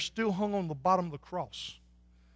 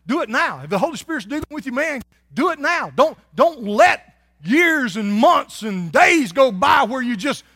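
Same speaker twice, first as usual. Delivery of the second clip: loud, higher, shouted preaching; preaching at full force; 5 semitones higher, 10 dB louder than usual. Acted. Pastor pleading with listeners, shouting urgently. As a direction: drive it home, urgent and loud. Preacher urges the congregation loudly.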